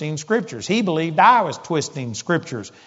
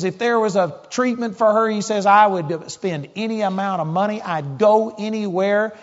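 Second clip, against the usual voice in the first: violent anger; 4 semitones higher than usual. Retold or imitated. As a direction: hammer each promise home, furious. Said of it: "he just was so violent".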